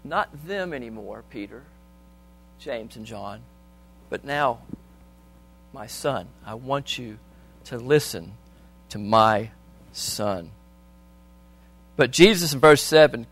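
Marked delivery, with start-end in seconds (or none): 0.0-10.5 softer, slow fatherly words, held back; the Father quietly correcting Peter, softly; gentle voice of the Father. Acted. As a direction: speak as the Father, hushed and tender. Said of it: "he comes out and he says".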